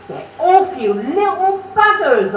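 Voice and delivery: high, loud telling-off; shout. Acted. Narrator shouting at yobbos poking rabbits, loud and high. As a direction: bark it at the naughty lads. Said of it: "I shouted in their ear".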